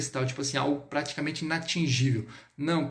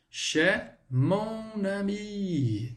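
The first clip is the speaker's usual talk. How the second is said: "chez mon ami" extremely slow, higher, long held vowels; very drawn-out model; 4 semitones higher, much slower than usual. Shown as a demonstration. Teacher giving students the answer, very drawn-out model. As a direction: stretch out every syllable of "chez mon ami".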